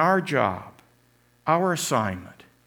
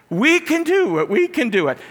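much higher, louder chant; very positive cheer; 13 semitones higher, 5 dB louder than usual. Retold or imitated. Head cheerleader at thirty-five nothing, loud high cheer. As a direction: cheer on blindly. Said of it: "The head cheerleader is still very positive".

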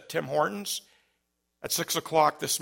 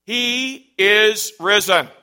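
higher, louder, slow call to the crowd; proclaimed greeting; 6 semitones higher, 12 dB louder, much slower than usual. Real, not acted. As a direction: call it out to the congregation. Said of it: "give you that ancient greeting".

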